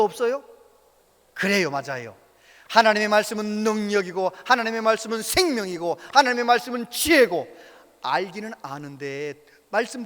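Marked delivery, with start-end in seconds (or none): 2.7-7.5 loud, rising preacher cadence; fervent preaching. Real, not acted. Preacher proclaiming God's word to the congregation, fervent. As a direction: proclaim each line with force.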